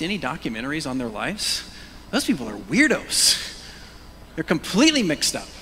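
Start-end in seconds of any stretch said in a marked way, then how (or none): 2.1-5.5 emphatic. Real, not acted. Pastor mocks royal family.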